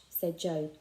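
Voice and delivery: usual speaking voice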